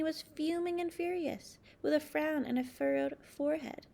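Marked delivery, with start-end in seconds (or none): none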